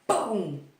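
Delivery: a loud punchy sound effect; a loud mock impact shout; loud boom.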